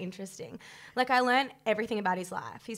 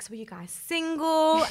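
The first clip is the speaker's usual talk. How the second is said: higher, drawn-out flirty question; slow, louder mock come-on; retold chat-up; 7 semitones higher, 5 dB louder than usual. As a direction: mimic a man fishing for a date. Retold man's chat-up line.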